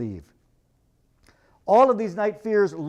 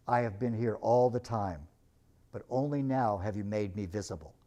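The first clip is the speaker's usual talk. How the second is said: Aslan's voice; 8 semitones lower, 5 dB quieter than usual. Acted. Aslan answers Lucy gently.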